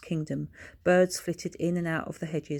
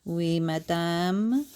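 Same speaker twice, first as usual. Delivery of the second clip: slow, breathy French maid reply; much slower than usual. A narrator's reading. Maid Edith answers her lady in a slow French manner.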